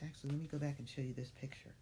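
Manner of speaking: ordinary delivery, nothing marked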